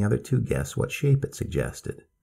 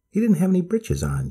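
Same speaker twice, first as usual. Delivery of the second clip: put-on high, blunt little girl; child voice; 10 semitones higher than usual. A narrator's reading. June Star remarks, child.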